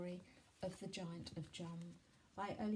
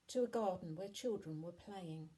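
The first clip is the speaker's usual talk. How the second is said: ordinary delivery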